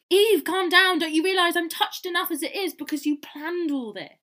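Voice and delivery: high, loud theatrical exasperation; exasperated plea. Acted. Oliver tells upset Eve to calm down, exasperated.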